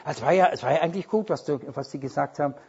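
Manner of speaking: fast, hurried half-praise; rushing through the compliment to reach the catch; rushed mock praise. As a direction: rattle off the polite part quickly. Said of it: "Da gehe ich schon innerlich".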